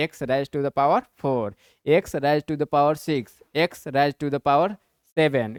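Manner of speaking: reading the powers out slowly; drawn-out teacher reading of "x raise to the power"; slow dictation. Shown as a demonstration. Teacher demonstrating how to read powers, slow and deliberate. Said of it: "demonstrates reading powers".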